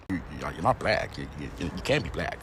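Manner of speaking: a slowed-down deep voice; deep mocking American voice. Mocking what Americans tell him, deep voice. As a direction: pitch way down, quiet and heavy. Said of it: "So what am I then?".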